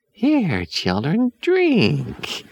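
put-on high, slow, sugary old woman; sweet witch voice. A narrator's reading. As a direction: coax them in a sickly sweet voice. Witch serves the tea.

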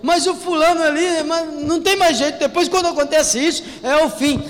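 high, loud doom-saying; doubter's complaint. Pretend. Preacher mimicking a gloomy doubter, high and loud. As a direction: pile on the hopeless gossip.

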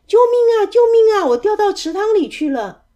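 very high, loud call for rescue; shouted cry for help. A narrator's reading. Hidden trickster pretending to drown, shouting. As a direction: yell for help, easing on the last words. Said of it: "那个声音叫着说".

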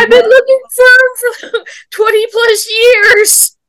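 very high wailing imitation, laughing; mock crying. Sister re-enacts telling the crying customer, mock wailing. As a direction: wail it in a squeaky send-up of yourself crying. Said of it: "you were crying".

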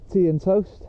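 high, showy announcement; big reveal. Real, not acted. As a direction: unveil it like a prize. Grand reveal of breakfast.

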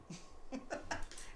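airy, soft burst of laughter; real laugh. Real, not acted. Laughing at the bartender's teasing. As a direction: crack up quietly. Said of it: "And I laughed".

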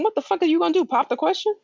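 high, shocked inner voice; shocked disbelief. Retold or imitated. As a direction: react in disbelief. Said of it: "And I'm thinking".

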